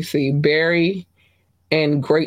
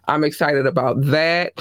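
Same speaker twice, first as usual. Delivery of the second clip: ordinary delivery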